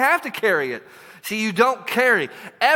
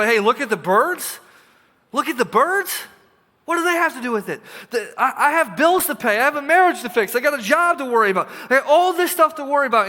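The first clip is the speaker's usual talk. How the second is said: higher, louder, exasperated protest; incredulous complaint; 5 semitones higher, 4 dB louder than usual. Acted. Worried listener protesting to Jesus, exasperated and loud. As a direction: throw up your hands in disbelief.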